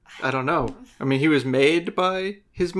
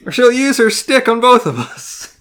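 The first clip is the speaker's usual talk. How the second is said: higher, louder mock plea through a laugh; apologetic henchman; 5 semitones higher, 6 dB louder than usual. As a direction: plead as a reluctant, harmless henchman. Acts out the sweet reluctant henchman.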